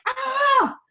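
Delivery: sudden high, loud yell; frustrated yell. Retold or imitated. Mom mimicking her own frustrated moments, sharp yell. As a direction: burst out in a fed-up shout. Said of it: "I still have moments where I'm like".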